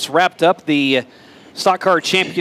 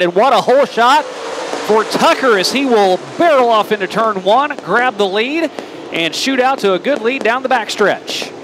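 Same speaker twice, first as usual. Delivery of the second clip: excited race play-by-play; motorsport commentator; 6 semitones higher than usual. Acted. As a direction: call the race as it unfolds, pitched up. Live race play-by-play call.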